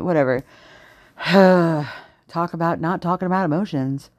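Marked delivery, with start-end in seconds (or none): 1.2-2.0 heavy audible exhale; exasperated sigh. Real, not acted. Fed up with a toxic pattern, exasperated sigh. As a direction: huff out a fed-up breath.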